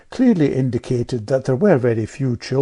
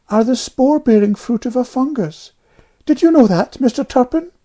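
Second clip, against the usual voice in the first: put-on high, louder piping girl; piping know-all child; 13 semitones higher, 6 dB louder than usual. A narrator's reading. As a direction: pipe up like a little know-all. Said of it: "piped Amelia".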